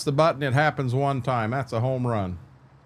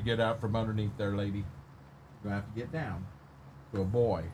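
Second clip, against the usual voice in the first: hushed dog talk; 11 dB quieter than usual. Real, not acted. Farmer tells jealous dog to get down for the turn, hushed. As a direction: quietly shoo the dog down.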